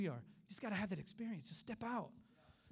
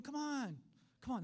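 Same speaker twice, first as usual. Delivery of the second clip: raised, high-pitched coaxing; coaxing instructor; 6 semitones higher, slower than usual. Retold or imitated. Preacher as swim teacher standing behind kids, coaxing. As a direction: call a nervous child towards you. Instructor coaxes child off the wall.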